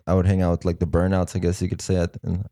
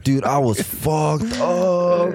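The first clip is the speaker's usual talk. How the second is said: slow, higher stoned-dude voice; mock stoner drawl; stoned buddy; 6 semitones higher, much slower than usual. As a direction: drag it out like a wasted friend. Friend retelling a wasted night.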